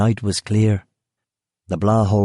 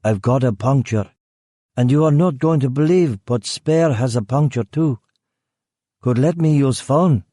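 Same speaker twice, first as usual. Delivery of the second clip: put-on higher, broken-English Eastern European accent; a foreigner faking a puncture story, lightly Eastern European; vague Eastern European accent; 4 semitones higher than usual. Killer poses as a stranded foreigner to the guard, vague Eastern European accent. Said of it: "in a vague Eastern European accent".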